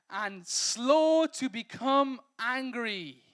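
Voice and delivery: slow, emphatic preaching of the verse; drawn-out emphasis. Shown as a demonstration. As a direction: press the verse out slowly. Preacher drawing out verse phrase.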